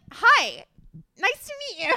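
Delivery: high, loud mock greeting; overdone loud, high-pitched hello; retold high-pitched greeting. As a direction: squeal a hello to a stranger, hammed up. Retold awkward first greeting of stranger.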